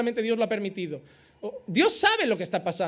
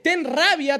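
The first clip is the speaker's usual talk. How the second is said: forceful command; 5 semitones higher, 5 dB louder, slower than usual. Acted. Flesh commands anger.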